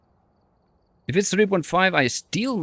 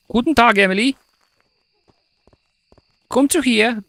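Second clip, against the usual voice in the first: high mock-bossy German orders; impatient German summons; 12 semitones higher than usual. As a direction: hurry the ghost up like a drill sergeant. Hurrying ghost in German.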